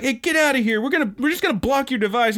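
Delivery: high, fast mock clerk voice; annoyed clerk. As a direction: shoo her off like a fed-up clerk. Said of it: "he's like".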